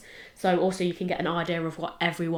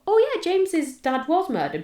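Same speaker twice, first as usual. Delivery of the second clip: high, louder oh-yeah moment; remembering it all at once; sudden recall; 11 semitones higher, 4 dB louder than usual. Retold or imitated. A host retells remembering the murder, surprised.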